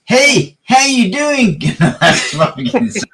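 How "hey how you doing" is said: put-on high American accent greeting; higher, hammed-up American accent hello. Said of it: "your guys' best American accent".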